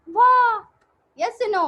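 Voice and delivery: loud, high delighted cry; excited wah. Acted. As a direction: burst out in wonder. Teacher exclaiming to kids.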